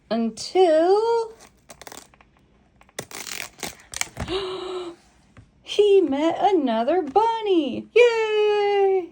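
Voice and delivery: very high, loud cheer; big excited yay. Shown as a demonstration. Reader cheering when the other bunny appears. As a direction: shout the yay with joy. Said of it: "everybody says yay at this part".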